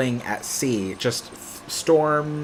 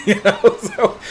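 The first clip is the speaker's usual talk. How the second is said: laughing; 9 semitones higher, 6 dB louder, slower than usual. Real, not acted. Laughs at his own fear.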